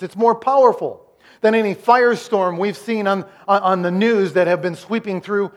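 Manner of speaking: usual speaking voice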